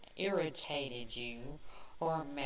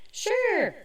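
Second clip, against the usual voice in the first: sarcastic; 7 dB louder than usual. Real, not acted. Sarcastic aside.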